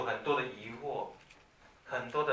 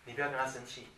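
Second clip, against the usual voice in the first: ordinary talk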